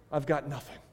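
quiet, downcast "I've got nothing."; a hint of a broken man, quiet; near tears. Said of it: "almost on the verge of tears".

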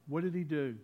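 ordinary delivery

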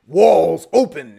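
loud, drawn-out miracle command; slow, forceful proclamation as if commanding walls; imagined command, stretched out loud; booming command. Pretend.

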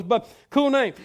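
ordinary talk

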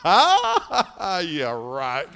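laughing, then a scoffing yeah right; laughing disbelief. Real, not acted. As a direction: laugh it off, not buying it.

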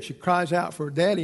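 ordinary delivery

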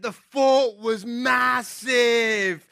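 put-on very slow, awed British kid voice; drawn-out British accent, childlike wonder; thick British accent. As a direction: mimic a little British boy stretching out his big word. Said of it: "thick British accent".

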